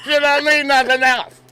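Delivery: high, loud laughing put-on voice; hammed-up husband bragging; mock husband voice. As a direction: shout it like a goofy husband through laughter.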